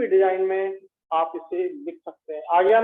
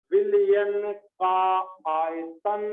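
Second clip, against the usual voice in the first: very slow, clearly separated words; much slower than usual. Shown as a demonstration. Teacher making every word clear to students, slow word-by-word delivery. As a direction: dictate it slowly, each word distinct. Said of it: "एक एक word clear होना चाहिए".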